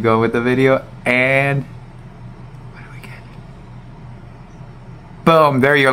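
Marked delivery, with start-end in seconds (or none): 2.7-3.2 whispered "what do we get?"; suspense whisper. Real, not acted. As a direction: hush before the reveal. Reveal of the roll.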